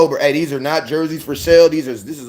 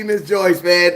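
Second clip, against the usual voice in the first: ordinary talk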